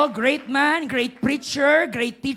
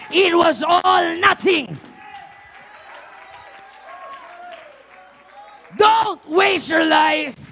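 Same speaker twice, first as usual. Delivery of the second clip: loud, raised pulpit shout; full-force preaching; 6 semitones higher, 6 dB louder than usual. Real, not acted. Preacher warning listeners about hell, full-force shout. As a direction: build to a ringing cry, stretching the final words.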